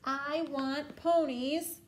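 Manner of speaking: loud, slow, high insistence; bellowed demand. A narrator's reading. Princess insisting on getting ponies, bellowing. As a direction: draw out each word loudly. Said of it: "bellowed the little princess".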